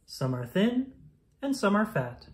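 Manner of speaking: ordinary delivery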